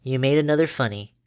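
usual speaking voice

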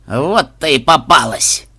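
slow, loud, gloating shout; triumphant blurt. A narrator's reading. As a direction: spit it out in triumph. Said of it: "выпалил судья".

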